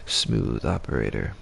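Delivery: low, slow smooth voice; a silky lounge-singer croon; smooth operator voice. Shown as a demonstration. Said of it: "In that smooth operator voice".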